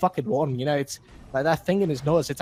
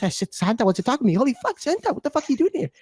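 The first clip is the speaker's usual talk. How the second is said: high-pitched, incredulous outburst; mock amazement at an unexpected visitor; acted astonishment; 8 semitones higher than usual. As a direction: let the pitch leap in disbelief. Narrator acts out meeting Santa.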